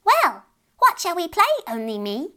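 put-on fairy child voice. Fairy child asks Beth to play.

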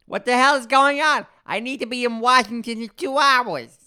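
high, loud, overblown delivery; imitated bad actor. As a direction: blare the line in a strained high put-on voice. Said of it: "And then he would go".

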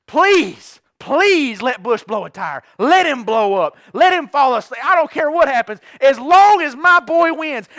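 hammed-up begging prayer, building; frantic mock prayer. Fan begs God for a caution flag, loud and hammed-up. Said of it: "I'm foaming at the mouth".

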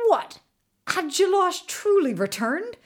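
high-pitched, outraged questions; enraged disbelief. A narrator's reading. Governor learns his rival is back alive, enraged disbelief. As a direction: burst out indignantly, pitch jumping up. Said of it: "was consumed with rage".

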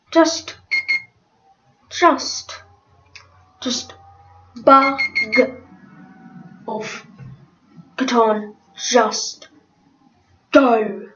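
halting, exasperated dismissal in bursts; short-tempered. Acted. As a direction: struggle to keep your temper, word by word. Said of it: "Someone's got a temper".

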